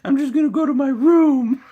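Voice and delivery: high, loud whiny crybaby; mocking crybaby. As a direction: parody of a protester sulking off to bed. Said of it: "That's what it sounds like".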